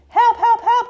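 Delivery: high, loud cries for help; crying for help. A narrator's reading. Stuck little truck to anyone nearby, crying for help. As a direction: call out urgently, high and loud. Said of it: "cried the little blue truck".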